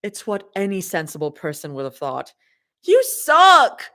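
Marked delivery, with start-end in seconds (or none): 2.8-3.8 high, loud playful outburst; cry out. Acted. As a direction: yell it at her. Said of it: "Zoe cries out".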